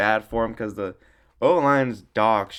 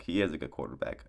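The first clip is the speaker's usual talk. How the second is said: quiet repeat; 10 semitones lower, 9 dB quieter than usual. Real, not acted. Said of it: "'Cause he is a good quarterback".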